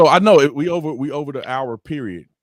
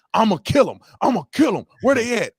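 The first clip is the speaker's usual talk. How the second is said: higher, louder furious threat; angry dad; 9 semitones higher, 4 dB louder than usual. Retold or imitated. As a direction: storm in like a fuming father. Furious dad at police station.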